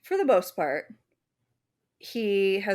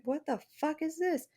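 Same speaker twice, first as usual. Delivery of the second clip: higher, hushed, bewildered what-the; baffled parent; 9 semitones higher, 5 dB quieter than usual. Retold or imitated. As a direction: stare at the drawing in disbelief.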